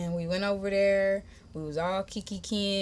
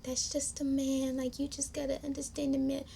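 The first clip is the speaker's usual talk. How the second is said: hushed, high, placating voice; gentle consoling; 7 semitones higher, 6 dB quieter than usual. Retold or imitated. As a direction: voice a woman soothing softly. Voices sister's wife consoling her.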